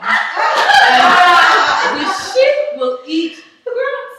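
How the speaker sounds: slow, rising, very high mock husband; husband's plan. Husband explaining the sheep plan, hammed-up. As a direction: stretch it out like an eager husband. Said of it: "he said".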